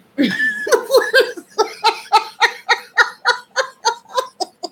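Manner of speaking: high burst of real laughter; long helpless giggle; hearty laugh. Real, not acted. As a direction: break up laughing at yourself. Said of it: "from laughing so hard".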